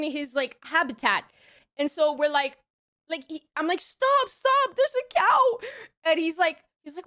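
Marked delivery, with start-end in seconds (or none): 3.8-5.6 high excited cry to stop the car; excited call. Retold or imitated. To her ex at the wheel, excitedly shouting to stop. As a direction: yelp it out as you spot the cow. Calling out to driver.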